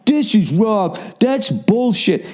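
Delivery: high, slow, snooty; mock rock snob. Mocking rock snobs, slow and high. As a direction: drag it out like a rock purist. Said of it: "somebody's always like".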